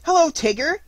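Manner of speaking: put-on high, loud childlike greeting; excited youngster's piping voice; eager Roo. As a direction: squeal a happy hello. Roo greeting Tigger back.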